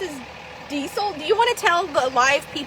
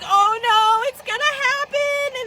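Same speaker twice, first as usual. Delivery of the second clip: mock dread; 7 semitones higher, slower than usual. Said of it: "Jess is like".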